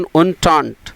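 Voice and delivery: entente said slowly as "oon-taant", both syllables clear; careful pronunciation. Shown as a demonstration. Teacher demonstrates pronunciation to the class, slow and clear. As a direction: pronounce entente deliberately as "oontaant". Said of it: "इसका उच्चारण".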